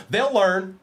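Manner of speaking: high laugh, then laughing through the words; gleeful laugh. Real, not acted. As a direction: cackle at the trash talker. Gloating over trash talk.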